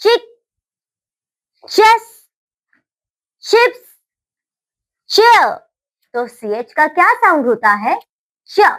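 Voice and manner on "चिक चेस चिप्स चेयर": read aloud. Shown as a demonstration. Teacher reading ch words aloud to children, bright and emphatic. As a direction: sing out each word for the class. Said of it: "Read aloud".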